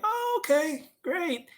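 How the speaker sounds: high-pitched acted "oh, okay"; uneasy relief. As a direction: take it in with a high, stretched "oh". Child's relieved, uneasy reply.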